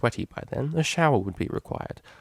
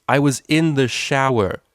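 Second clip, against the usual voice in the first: indignant; 4 semitones higher, 7 dB louder than usual. Acted. Indignant reminder.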